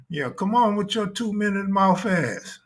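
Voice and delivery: ordinary delivery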